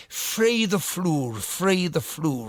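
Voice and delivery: "Free the floor" slow, with a falling end, the Irish mother's way; slow mock Irish mother saying "free the floor"; Irish mother's mispronunciation. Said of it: "which means flirt with the floor rather than".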